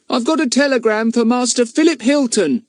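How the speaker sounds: put-on high, boyish delivery voice; errand boy. A narrator's reading. Telegraph boy announces the telegram, boyish and bright. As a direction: announce it like a young messenger.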